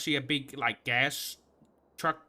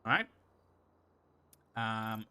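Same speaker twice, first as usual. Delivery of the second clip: normal talking voice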